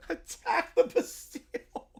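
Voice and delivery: breathless laughing repeat of the cry; wheezing laugh. Real, not acted. Streamer repeating the line while laughing. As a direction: barely get it out through laughter.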